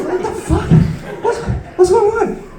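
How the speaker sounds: high, mock-bewildered dad reaction; baffled dad. Dad answers his kid's bizarre question, high and bewildered. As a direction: act out a dad thrown by the question.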